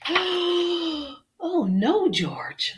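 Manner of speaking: long high oh, then falling; dismayed mother. Acted. Mother dog hearing George quack, theatrical dismay. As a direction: lament the pup's mistake theatrically.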